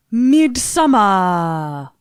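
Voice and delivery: drawn-out, loud, botched Swedish accent; creepy Swedish accent. Shown as a demonstration. Announcing the trailer's title, creepy Swedish accent. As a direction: stretch it out in an eerie mock-Swedish accent. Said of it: "I was going for, like, a creepy Swedish accent".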